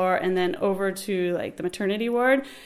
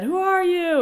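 front desk challenge; 10 semitones higher, 3 dB louder, slower than usual. Retold or imitated. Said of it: "and he said".

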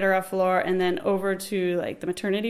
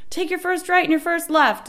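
called-out directions; 9 semitones higher, 3 dB louder than usual. Retold or imitated. Desk guy calling out directions.

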